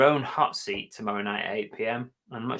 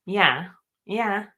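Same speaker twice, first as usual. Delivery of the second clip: higher-pitched mock Scouse; Liverpool accent; 6 semitones higher than usual. Co-host doing regional impressions, Liverpool accent. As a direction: put on a caricature Liverpool accent. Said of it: "In Liverpool".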